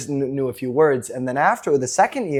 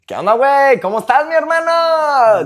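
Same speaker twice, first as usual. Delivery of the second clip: Mexican accent; 9 semitones higher, 5 dB louder than usual. Shown as a demonstration. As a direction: call out in a hammed-up Mexican accent. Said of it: "the background coming from Mexico".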